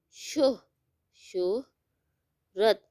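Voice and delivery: teacher sounding out شہرت syllable by syllable. Shown as a demonstration. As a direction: break شہرت into شہ and then the whole word, slowly. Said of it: "شہرت میں جو آواز".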